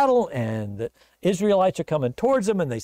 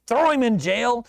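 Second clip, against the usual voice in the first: higher, sharper command; irritated king's order; 7 semitones higher, 4 dB louder than usual. Acted. King Ahab to Micaiah, irritated command. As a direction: order a prisoner taken away.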